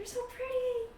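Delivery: high-pitched, admiring coo; gushing wife. Acted. Wife complimenting the general's partner, high gush.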